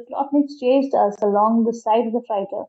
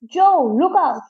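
raised, urgent warning cry; shouted warning; 6 semitones higher, 3 dB louder than usual. A narrator's reading. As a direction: call out a sudden warning, higher.

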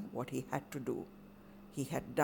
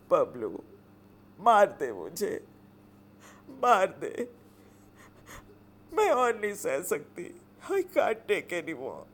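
pleading through tears, voice breaking; anguished whimper; 3 semitones higher, 4 dB louder than usual. Acted. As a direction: beg him to hit her, worn out with grief. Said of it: "whispered in a hoarse, anguished whimper".

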